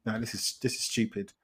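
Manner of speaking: recalling watching the film, a quiet scoffing mutter; muttered aside. Retold or imitated. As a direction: say it under your breath, unimpressed. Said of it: "I remember this thinking".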